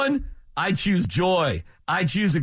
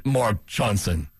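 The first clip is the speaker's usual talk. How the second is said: "Mark Johnson" drawn out, lower and slower; lower, slower name; 8 semitones lower, slower than usual. Shown as a demonstration.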